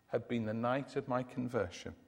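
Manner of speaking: usual speaking voice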